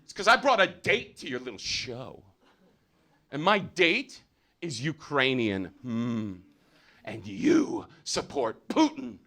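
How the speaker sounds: high indignant accusation, building in pitch; mock fury, drawn out toward the end; imitated angry scolding. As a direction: scold like a hammed-up outraged woman. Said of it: "I'll tell you why I'm mad".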